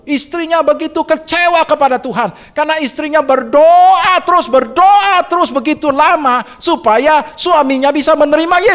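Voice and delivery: preacher building to a passionate peak; fervent preaching. Acted. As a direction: drive the story home from the pulpit. Preaching with rising intensity.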